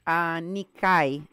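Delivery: "Anikai" drawn out slowly, ending like "kai"; imitated name pronunciation. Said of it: "The, she says".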